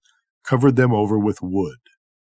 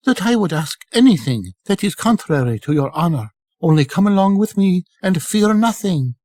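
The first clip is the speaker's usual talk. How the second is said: Marjana voiced much higher, coaxing; reassuring servant girl; 9 semitones higher than usual. A narrator's reading. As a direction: soothe the nervous cobbler in a high girl's voice.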